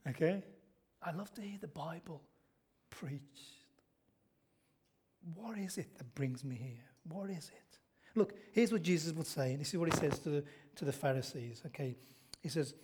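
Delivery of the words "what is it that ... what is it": unvoiced, hushed rhetorical question; whispered question. Real, not acted. Preacher asking the congregation why they come, whispered. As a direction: draw them in with a whisper.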